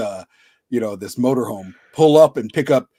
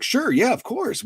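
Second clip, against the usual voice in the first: much higher, agreeable mock reply; imitated eager agreement; 12 semitones higher, slower than usual. Radio hosts welcoming a guest's request, imitated eager agreement. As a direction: imitate hosts readily saying yes.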